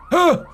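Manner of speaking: high, loud "huh"; sharp mock parkour grunt; high-pitched burst of effort; exertion grunt. Effort noise while pretending parkour.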